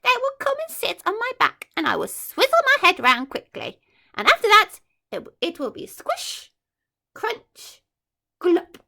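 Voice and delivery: high, fast, gleeful monster voice; gleeful crocodile. Acted. Crocodile boasting how he'll eat the children, high gleeful glee. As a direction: relish every crunch like a greedy beast. Crocodile relishing his plan.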